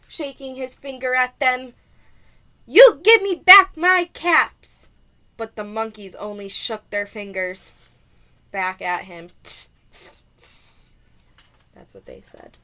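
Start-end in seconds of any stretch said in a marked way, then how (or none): 2.7-4.9 slow, loud, higher angry demand. Acted. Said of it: "This made the peddler very angry". 9.4-10.9 unvoiced tss sounds; monkey tss hiss. Monkeys' reply.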